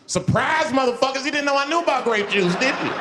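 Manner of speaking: high, loud triumphant taunt; crowing at the silent audience; gleeful gotcha. Real, not acted. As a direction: rub it in with glee.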